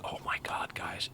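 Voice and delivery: hushed whisper to chat. Real, not acted. Streamer whispering to chat so the cat stays.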